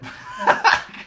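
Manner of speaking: sudden high, loud laugh; high-pitched giggle bursting out; burst of laughter. Real, not acted. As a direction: crack up loudly. Laughs at own bagel story.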